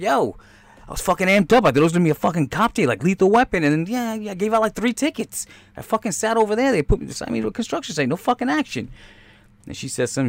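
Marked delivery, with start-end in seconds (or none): 0.0-8.9 higher, griping cop complaining; lightly voiced bored cop. Cop griping at home about no action, mild whine. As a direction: whine about a dull shift. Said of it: "'cause they're like".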